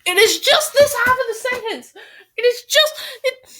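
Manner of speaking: high-pitched exasperated outburst; upset. Real, not acted. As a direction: protest at yourself, voice shooting up. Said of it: "I'm so upset".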